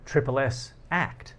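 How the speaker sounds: ordinary talk, nothing marked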